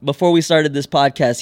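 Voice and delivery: ordinary delivery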